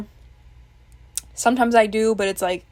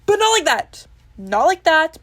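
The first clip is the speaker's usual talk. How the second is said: flustered denial; 8 semitones higher, 7 dB louder than usual. Real, not acted. Laughing denial.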